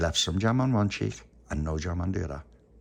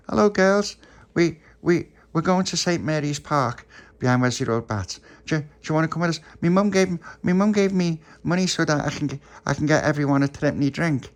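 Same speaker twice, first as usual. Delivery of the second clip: excited puppy voice; 8 semitones higher, 6 dB louder than usual. Acted. Little puppy greeting the girls.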